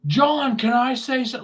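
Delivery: very high, pleading mock voice; mocking whine. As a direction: squeak it like a kid asking permission.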